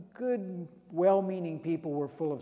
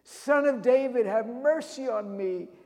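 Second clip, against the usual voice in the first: loud, higher desperate plea; near scream; 5 semitones higher, 5 dB louder than usual. Retold or imitated. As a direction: cry out louder, almost screaming. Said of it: "he's almost screaming it out".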